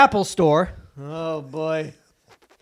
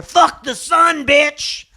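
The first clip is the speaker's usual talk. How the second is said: slow, hammed-up yell, higher and louder; mock yell; 7 semitones higher, 4 dB louder, much slower than usual. Mocking gas lovers, a slow hammed-up yell. Mock polluter yelling at solar power.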